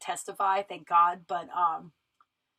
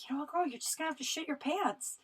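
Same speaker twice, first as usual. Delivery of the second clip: a higher, mock-encouraging voice to herself; pep talk to herself; 5 semitones higher than usual. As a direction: coax yourself like a sassy best friend. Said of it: "And I was like".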